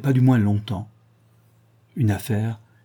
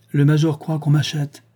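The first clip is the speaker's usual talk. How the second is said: higher-pitched, haughty scorn; disdainful; 5 semitones higher, 3 dB louder than usual. A narrator's reading. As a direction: say it with a disdainful smile. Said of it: "dit Pepa avec un sourire dédaigneux".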